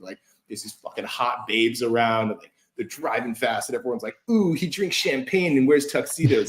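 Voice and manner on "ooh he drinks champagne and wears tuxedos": mock admiring onlooker. Mocking ad voices admiring driver.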